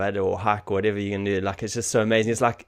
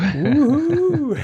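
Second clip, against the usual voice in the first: high squealing ooh; a delighted, teasing ooh; playful hyped-up coo; excited ooh; 8 dB louder than usual. Real, not acted.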